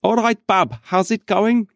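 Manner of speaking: high-pitched, put-on Birmingham accent; strong Brummy accent. Shown as a demonstration. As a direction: do a rough, exaggerated Brummy greeting, pitched right up. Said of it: "quite a strong Brummy accent".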